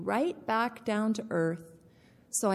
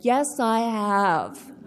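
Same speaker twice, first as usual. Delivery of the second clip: proud yes; 6 dB louder, much slower than usual. Retold or imitated. Proud reply to sponsor.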